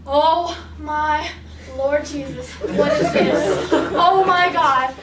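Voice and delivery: normal talking voice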